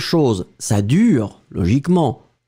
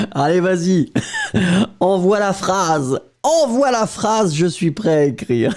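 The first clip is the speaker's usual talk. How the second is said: playfully raring to go, higher voice; Mélodie, combative; 4 semitones higher than usual. Retold or imitated. Teacher playing eager student who wants the next sentence, combative. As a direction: egg the teacher on with mock fighting spirit. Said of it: "Il faut avoir une attitude comme ça".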